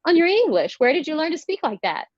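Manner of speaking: high-pitched imitation of an older lady; put-on high voice of a patronizing stranger. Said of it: "she was like".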